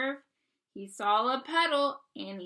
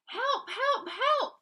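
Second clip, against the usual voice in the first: high loud calls for help; high panicked cry for help; 11 semitones higher, 5 dB louder, slower than usual. Acted. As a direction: call out high, frantic for help. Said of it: "cried the little blue truck".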